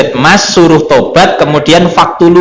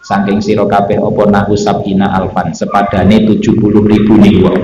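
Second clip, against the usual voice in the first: steady, level-toned reading, lower than the talk; scripture recitation with gloss; 4 semitones lower than usual. A narrator's reading. Teacher reading Arabic text with Javanese translation, steady intoned recitation. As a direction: intone the text phrase by phrase in a monotone.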